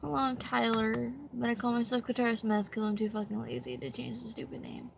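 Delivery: ordinary delivery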